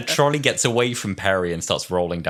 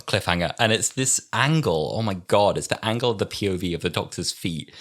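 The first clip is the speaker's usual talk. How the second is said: ordinary delivery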